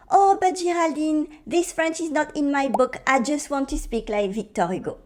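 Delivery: put-on whiny objection; complaining student. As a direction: imitate a stuck-in-the-book learner objecting. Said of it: "Many people stay stuck at the".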